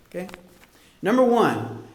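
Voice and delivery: normal talking voice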